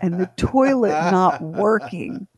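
deliberate, drawn-out interrogation; slow, pointed questioning. Retold or imitated. Mother questions her child about the toilet, slowly and pointedly. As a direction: spell it out slowly, with pauses.

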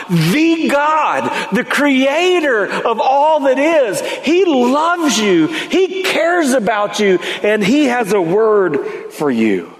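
high, slow, emphatic proclamation; impassioned preaching. Real, not acted. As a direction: proclaim it to the congregation, stretching key words. Preacher exhorts the congregation.

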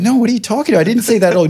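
high-pitched, flustered retold denial; mock freaking out. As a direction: act out a panicked denial, pitch jumping up. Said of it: "now I'm freaking out".

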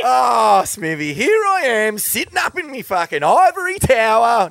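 high, loud, hammed-up sarcastic protest; over-the-top sarcastic outburst; sarcastic rant. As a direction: shout it like mocking your own innocence. Sarcastic mock self-defence rant.

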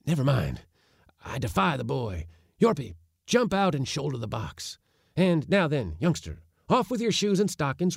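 put-on high, eager old man orders; commanding old uncle. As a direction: hurry the crew, careful but keen. Uncle directing the crew.

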